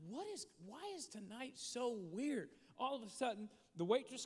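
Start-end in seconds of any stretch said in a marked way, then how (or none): 0.1-2.5 higher-pitched mock bewilderment; baffled. Husband wondering about the strange night out, baffled. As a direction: puzzle aloud in exasperated disbelief. Retelling bafflement at odd seating.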